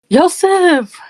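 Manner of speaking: high, loud cry of a friend's name; all-caps call-out. Retold or imitated. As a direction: call it out sharply, like texting in capitals. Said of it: "In all caps".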